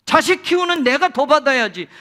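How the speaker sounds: loud, high resentful rival wife; angry complaint. Retold or imitated. Jealous rival wife confronting husband, loud and bitter. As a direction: spit out the jealous grievance. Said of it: "분인나 입장에서 이렇게 말할 수 있잖아요".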